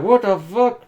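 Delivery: high, loud incredulous outburst; shocked reaction. Retold or imitated. Recalling a first reaction to the fangs, loud shock. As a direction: blurt it out in disbelief. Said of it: "At first, I was like".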